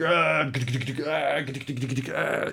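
mock action-figure battle cries with gun noises; imitated gunfire yells. Player voicing toy soldiers shooting pop-up targets, imitated gunfire. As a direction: play-act shooting, drawn-out "ah" between bursts. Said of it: "my guys would be like".

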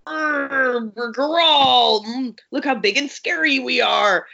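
hammed-up big scary bear; growling monster act. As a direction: ham it up like a lumbering beast. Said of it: "are like".

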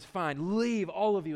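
usual speaking voice

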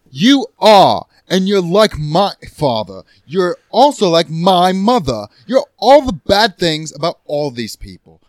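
loud, deliberate condemnation; heated, drawn-out argument; angry accusation. Acted. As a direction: hammer each accusation slowly and forcefully. Acted couple's quarrel, louder, slower.

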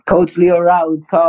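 real laugh breaking into high-pitched words; can't get the name out without laughing; laughing while talking. Real, not acted. Player recalls the coach's unbelievable call, laughing. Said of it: "can't even say that without laughing".

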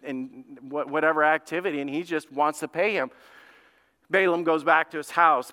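ordinary talk

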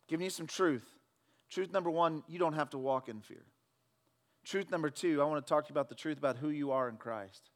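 normal talking voice

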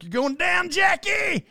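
sudden high-pitched shout; hammed-up high holler as you wipe out; retold yell. Retold cry during scooter crash.

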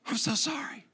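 breathy whispered mock apology; mock whispered sorry. Spouse's apology trails into a hammed-up whisper. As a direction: whisper it like a melodramatic confession. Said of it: "You want your spouse to just be".

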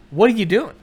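high, loud demand as an angry wife; mad wife. Acted. Wife catches husband watching the movie, angry. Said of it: "she gets mad immediately".

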